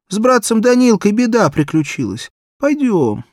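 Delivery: put-on high, loud child's voice, anxious; a frightened little girl begging for help; little girl pleading. Barefoot girl asks every passer-by, high pleading child voice.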